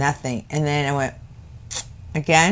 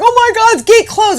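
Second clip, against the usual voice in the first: mock panic; 13 semitones higher than usual. Panicked retold quote.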